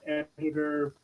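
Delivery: usual speaking voice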